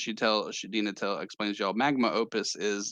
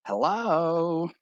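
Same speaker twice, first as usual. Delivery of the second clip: put-on higher, drawn-out Valley Girl greeting; 8 semitones higher, 4 dB louder than usual. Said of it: "kinda like a Valley Girl".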